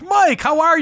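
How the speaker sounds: high, bright mock greeting from a volunteer; eager volunteer greeting. Volunteer happily greeting the worship leader by name, high mock cheer. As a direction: call out his name like an old friend. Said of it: "they're like".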